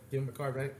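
ordinary talk

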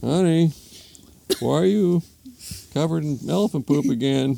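put-on husband voice; puzzled husband. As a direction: ask it high, in bewilderment. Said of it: "Husband comes downstairs".

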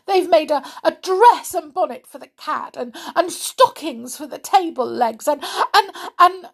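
high, upset complaint; near tears. A narrator's reading. Sally complaining to Mother Click-Clack, high and tearful. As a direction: whine it, almost crying. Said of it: "She was almost in tears".

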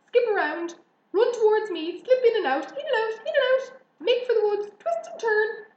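very high, loud rapid-fire commands; urgent orders. A narrator's reading. Goose directing the pig's escape, frantic commands. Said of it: "began to give orders".

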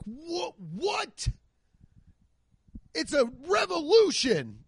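high, breathless mock awe at a new phone; mock amazement. Fans gasping at the new iPhone, mock amazed. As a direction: gasp it out like fans seeing a miracle.

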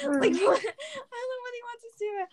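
high, whining half-sob; a mock-tearful high whine; whiny mock cry. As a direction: whimper it like a wronged student. Complaining about yelling teacher.

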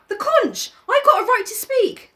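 put-on high protesting boy; Piggy voice. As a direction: insist on your right to speak. Piggy voice held.

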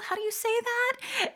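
quoting a puzzled friend with a high rising question; mock puzzled friend. Friends who can't pronounce it, asking high and puzzled. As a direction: ask it like a confused friend. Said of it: "my non-Spanish speaking friends ask me".

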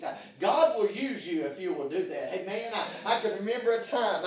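ordinary talk

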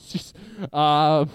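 ordinary talk

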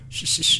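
demonstrated voiceless "shish, shish"; two short shushes as a signal; nonverbal hiss. Shown as a demonstration. Telling of sounds that amuse a Chilean friend, hiss. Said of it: "comunicación no verbal que tenemos nosotros".

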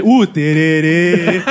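movie trailer voice. Acted. Mock soundtrack title.

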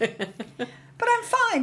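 high, very slow mock-cheerful "fine"; sarcastic. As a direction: fake brightness, drawn way out. Sarcastic high fake reassurance.

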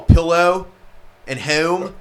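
loud mock Philly-area vowels; mocking Philly drawl; mock Philly accent. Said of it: "I don't sound like Bam".

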